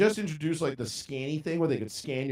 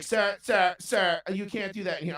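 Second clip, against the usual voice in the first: retold cashier scolding; 6 semitones higher than usual.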